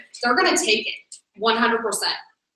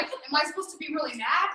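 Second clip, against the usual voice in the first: soft, raised-pitch imitation of confused friends; timid question; 4 semitones higher, 9 dB quieter than usual. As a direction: ask meekly, barely daring. Acts out confused friends asking.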